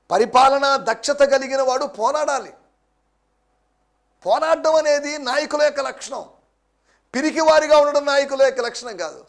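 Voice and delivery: rising pulpit intensity; forceful preaching. Acted. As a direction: drive the point home from the pulpit. Preacher declares leadership traits.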